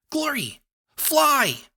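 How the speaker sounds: high, loud desperate yell; shouting. A narrator's reading. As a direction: scream the warning. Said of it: "I shouted".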